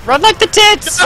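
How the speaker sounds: high, loud panicked yell; panicked shout. Real, not acted. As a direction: yell it while fleeing. Panicked escape in game.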